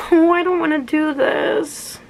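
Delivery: half-laughing whimper; whiny dread. Real, not acted. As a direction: plead with yourself not to. Dreading taking piercing out.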